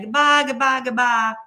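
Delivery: mock loud goodbye. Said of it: "And Alan's saying".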